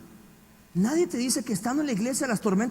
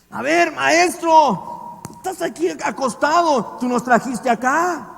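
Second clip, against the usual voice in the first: loud, slow, higher accusing call; accusing demand; 6 semitones higher, 6 dB louder, slower than usual. Retold or imitated. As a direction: drag the words out, pointing the finger. Disciples waking the blamed master.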